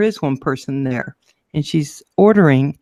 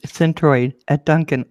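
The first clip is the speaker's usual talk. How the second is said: ordinary delivery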